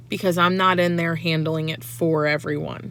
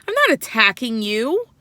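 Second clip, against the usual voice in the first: raised, higher repeat of I'm not attacking you, pushing NOT; second denial louder than the first; getting loud; 4 semitones higher, 6 dB louder than usual. Retold or imitated. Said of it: "And then I got loud".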